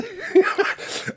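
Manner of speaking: high burst of amused laughter; high, gleeful cackle; real laugh. Real, not acted. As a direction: crack up at your own impression. Laughs at own impression.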